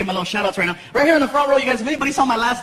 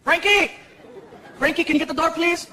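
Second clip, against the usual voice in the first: higher calling voice; calling son; 6 semitones higher, 3 dB quieter than usual. Retold or imitated. Dad calling son to get the door, raised pitch. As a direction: call across the house, pitch up.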